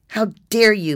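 loud, high, furious outburst; angry yell. A narrator's reading. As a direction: yell it at the caller, outraged. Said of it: "Maggie yelled".

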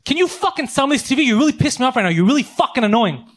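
high, loud retold outburst; losing his temper. Fed-up customer to the TV salesman, losing his temper. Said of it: "I lost my fucking shit".

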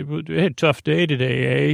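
ordinary talk, nothing marked

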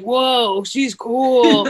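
impressed bro voice, hammed-up; mock American dude. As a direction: impersonate a dazzled American guy. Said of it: "They're just like".

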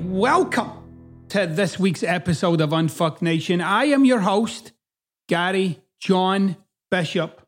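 ordinary delivery

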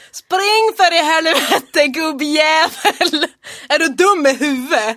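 loud, slow, mock dialect shout sliding into Stockholm; failed Hälsinge imitation. Shown as a demonstration. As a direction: try the Järvsö yell, lose it halfway. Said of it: "Nu blev det stockholmska". (misslyckad imitation av hälsingemål)

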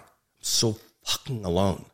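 normal talking voice

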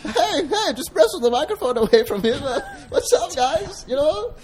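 very high, laughing alter ego; chirpy intruder grabbing the mic; high silly persona voice. Pretend. Another persona grabs the microphone.

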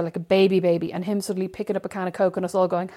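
normal talking voice

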